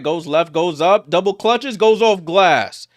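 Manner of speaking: building to a loud, high call; excited play-by-play. Real, not acted. Commentator calls a double-clutch score, excitement building. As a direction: lift each move toward the basket.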